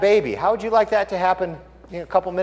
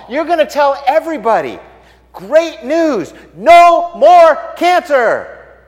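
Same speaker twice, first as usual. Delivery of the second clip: exuberant proclaiming; 7 semitones higher, 6 dB louder than usual. Acted. Someone with the cure for cancer telling everybody, exuberant. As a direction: rattle off the places excitedly, then proclaim.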